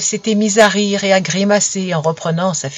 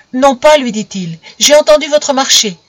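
higher, louder refusal; defiant boy; 5 semitones higher, 6 dB louder than usual. A narrator's reading. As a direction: stand up to the old woman.

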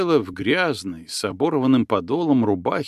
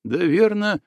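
ordinary delivery

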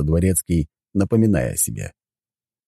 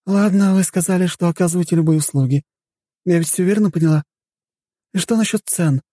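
very high-pitched woman's voice; inquiring lady guest; 3 dB louder than usual. Acted. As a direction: check the terms as a hotel guest. Voices the woman questioning the butler. (дотошная гостья, высоким голосом)

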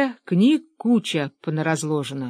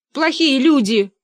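loud, high, drawn-out reproach; high cry; 7 semitones higher, 5 dB louder than usual. A narrator's reading. Fyodor Kuzmich reproaches hunters from hiding, high cry. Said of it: "крикнул из четвёртого".

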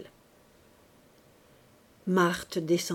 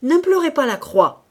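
high, much louder furious shout; furious cry; 7 semitones higher, 10 dB louder than usual. Acted. The priest in a fury, shouting at Marthe. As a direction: cry out in a rage. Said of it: "s'écria le prêtre au comble de l'emportement".

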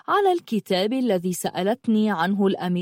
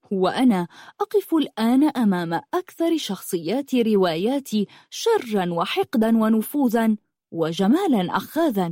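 ordinary delivery

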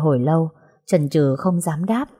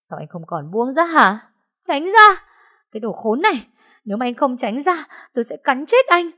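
frantic screaming; 7 semitones higher than usual. Acted. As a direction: scream him away in hysterical rage. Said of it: "điên cuồng gào thét".

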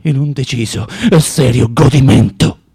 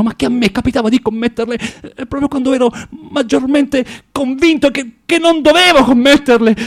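building agitation; 9 semitones higher than usual. Acted. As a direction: work yourself up until the words burst out. Agitated confession, voice climbing.

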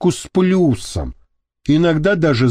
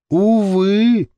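sad sigh; 6 semitones higher than usual. Acted. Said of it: "печально вздохнул Вася".

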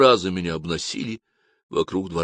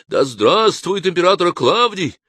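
high, loud, slow acclamation; loud cheer; 10 semitones higher, 5 dB louder than usual. Acted. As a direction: hail him as emperor at full voice. Crowd hailing emperor loudly.

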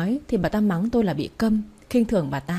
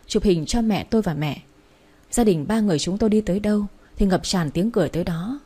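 ordinary delivery

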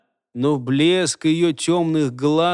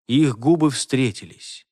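ordinary delivery

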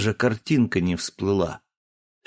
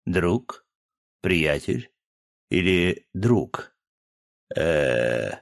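lower, hesitant mock-bashful voice; feigned embarrassment; 5 semitones lower, much slower than usual. A narrator's reading. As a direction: play coy, pausing over each word. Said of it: "голос имитировал смущение".

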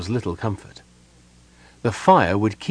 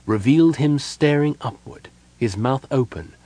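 usual speaking voice

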